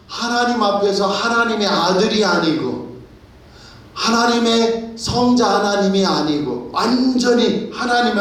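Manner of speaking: full-force preaching. Acted. Preacher to congregation, fervent full-force preaching. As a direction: preach it with mounting passion. Sermon climax on the crucifixion.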